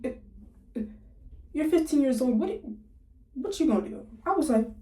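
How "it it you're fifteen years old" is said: usual speaking voice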